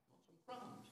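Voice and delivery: hushed unvoiced whisper; a voice softly offering crumbs, whispered. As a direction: breathe it out as a whisper.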